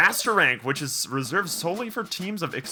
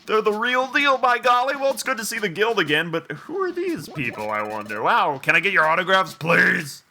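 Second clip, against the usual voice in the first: high, loud, gushing fan voice; breathless-with-admiration, higher-pitched gush; excited game character; 5 semitones higher, 3 dB louder than usual. Acted. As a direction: play a starstruck admirer, voice pitched up.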